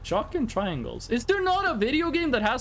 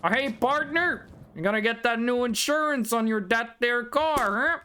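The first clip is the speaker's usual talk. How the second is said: put-on American accent. Retelling talking to Boba in American accent. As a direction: lay on a cowboy salesman's American twang. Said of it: "an American accent".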